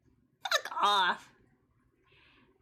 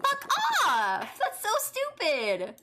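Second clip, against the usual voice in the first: angry outburst; 7 semitones higher, slower than usual. Real, not acted. Raging at the game's judge.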